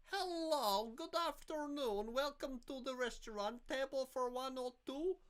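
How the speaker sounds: very high put-on waitress voice; sing-song, high-pitched mock greeting to diners; Jana. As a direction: mimic a foreign waitress in a squeaky-high, drawn-out voice. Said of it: "Jana used to talk like this".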